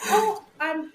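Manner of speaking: a high startled yelp; retold flustered gasp. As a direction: caught off guard, voice jumps up. Mother startled by nurse.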